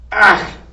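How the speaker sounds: loud disgusted ach; disgusted exclamation. A narrator's reading. Cook spotting a mouse in her oil, loud disgusted ach. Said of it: "said Cook".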